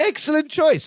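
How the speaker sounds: high-pitched pleased imitation; approving Balky. Balky praising Larry's pick, high approving voice.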